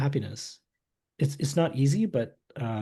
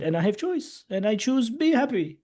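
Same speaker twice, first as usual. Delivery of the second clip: voicing the Dalai Lama; 11 semitones higher than usual. Retelling the Dalai Lama's words.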